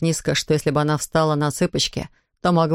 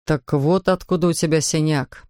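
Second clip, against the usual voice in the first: ordinary delivery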